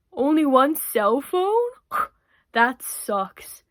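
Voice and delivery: dumbfounded, drawn-out disbelief; dim rebel boy. Acted. Boy misunderstanding his biology tutor, dimly dismayed.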